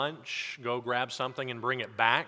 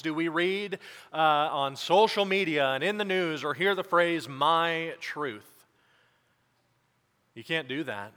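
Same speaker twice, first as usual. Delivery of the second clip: raised, ringing pulpit voice; preaching; 3 semitones higher, 4 dB louder than usual. Acted. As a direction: preach it out to the congregation with conviction.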